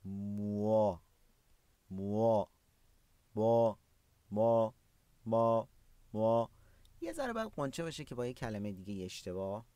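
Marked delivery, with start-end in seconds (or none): 0.1-6.5 "موا" as one syllable, "mwa", lips puckered; "موا" with a rounded w glide before the a. Shown as a demonstration. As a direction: repeat "ما" as "mwa" with pursed lips, separately and firmly.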